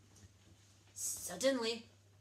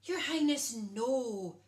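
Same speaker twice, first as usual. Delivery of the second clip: begging; 3 semitones higher, 7 dB louder, slower than usual. A narrator's reading. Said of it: "begged the cook".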